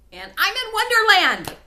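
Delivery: very high, loud joyful cry; excited shout. Real, not acted. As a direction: scream it out in triumph. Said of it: "Sorry, I'm a little excited about this".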